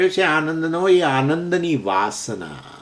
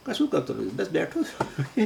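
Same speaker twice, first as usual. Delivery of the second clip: casual laughing reply; 2 semitones higher, 7 dB quieter than usual. Retold or imitated. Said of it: "phone કરીએ ને કોઈકને તો કે".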